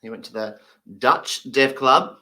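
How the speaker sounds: usual speaking voice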